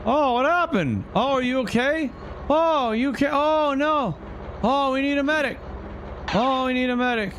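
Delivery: high, urgent mock alarm; man down shout. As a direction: call out for a medic in alarm. Crash in race, calling for help.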